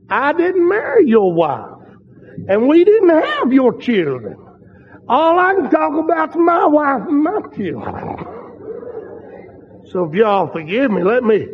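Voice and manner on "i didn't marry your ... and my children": indignant retort. Retold or imitated. Preacher's retort to blunt critic.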